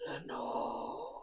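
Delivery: whispered echo from far off, high and airy; ghostly echo. Acted. The echo answers the narrator, a faint whispered echo. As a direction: breathe it like a distant echo. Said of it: "an echo murmured back the word".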